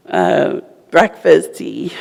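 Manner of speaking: ordinary talk, nothing marked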